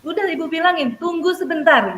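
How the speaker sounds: berteriak jauh lebih tinggi dan keras; teriakan guru. Retold or imitated. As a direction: membentak balik karena terpancing. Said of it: "saya juga teriak". (guru terpancing emosi berteriak pada murid)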